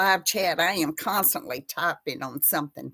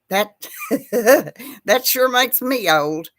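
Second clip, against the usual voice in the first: laughing; 4 semitones higher, 7 dB louder than usual. Real, not acted. Laughing at her own age.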